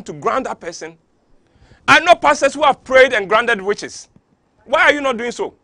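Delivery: heated, loud outburst at the pastors; really fired up, hammering each question; worked up. Real, not acted. Preacher railing at fake pastors, heated and loud. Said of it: "I'm getting worked up with this".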